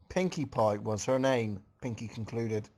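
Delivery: ordinary delivery, nothing marked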